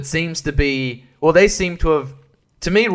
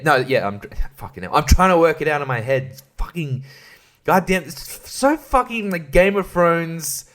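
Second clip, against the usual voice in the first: ordinary talk, nothing marked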